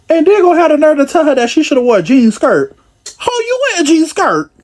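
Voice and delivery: high, outraged retelling; outraged disbelief. Real, not acted. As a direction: tell it like you can't believe it. Outraged retelling, much higher.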